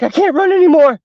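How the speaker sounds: high, strained acted wail; winded panic. Winded friends fleeing down the stairs, high panicked wail. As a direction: cry out as if you can go no further. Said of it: "some of them ran out of breath".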